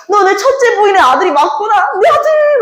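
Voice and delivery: high, swelling cry of a father; hammed-up overjoyed father; joyful recognition. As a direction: rise to a very high "my son!". Father joyfully recognizes his son.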